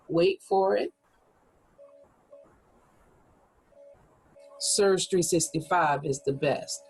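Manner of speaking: wait for it, a long pause, then the reveal; pregnant pause. Shown as a demonstration. Teacher shows the class a dramatic pause, then reveals. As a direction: hold the silence before the punchline. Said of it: "a pregnant pause might be".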